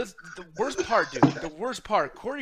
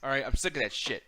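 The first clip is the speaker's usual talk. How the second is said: normal talking voice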